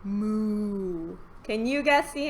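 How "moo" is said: low, drawn-out moo; cow moo. Pretend. Giving children an animal clue, low mooing. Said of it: "animal that says".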